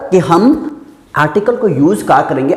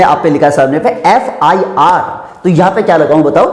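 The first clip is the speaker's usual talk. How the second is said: usual speaking voice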